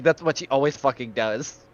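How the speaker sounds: ordinary delivery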